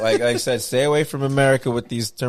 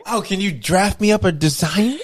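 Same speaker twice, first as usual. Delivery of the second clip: mock coworker; 8 semitones higher, slower than usual. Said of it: "web design".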